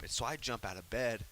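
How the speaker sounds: ordinary talk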